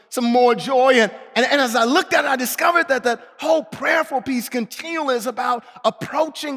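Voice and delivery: loud, high, driving sermon voice; fervent preaching. Acted. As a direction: preach it out full force. Preaching at full force.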